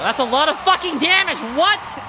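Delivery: high, loud disbelieving shout; shocked outburst. Real, not acted. Streamer stunned by huge damage, high loud shout. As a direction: gasp it out in disbelief.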